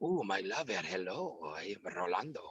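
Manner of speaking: low, soft Latin-lover voice; hushed Antonio Banderas-style seducer; Rolando. Acted. Comedian demonstrates his phone-prank lover character, low and soft. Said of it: "my Rolando would be Antonio Banderas".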